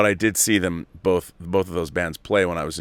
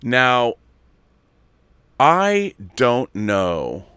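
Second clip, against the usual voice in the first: ordinary delivery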